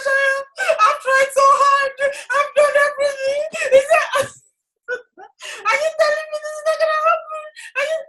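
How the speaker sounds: high wailing mock sob; imitating crying. Mimicking Bridget crying on the call, high wailing mock sob. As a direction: whine it like a tearful plea. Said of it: "she was crying".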